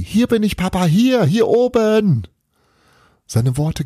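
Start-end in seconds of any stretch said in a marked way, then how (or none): 0.0-2.3 put-on very high, loud calling child; shouting out the window, desperate to be seen; boy's scream. A narrator's reading. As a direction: yell up to the father, waving.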